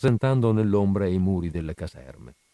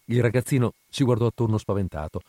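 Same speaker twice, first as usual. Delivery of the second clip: plain reading voice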